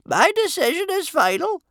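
very high, loud, final ruling; adamant chief judge. A narrator's reading. Judge ending the argument with Bertha, adamant high voice. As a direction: close the matter in a high official voice.

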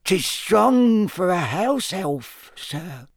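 high, slow squeaky elf; earnest house elf. A narrator's reading. Dobby corrects Harry in a high slow elf voice. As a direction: insist politely, like a small servant elf.

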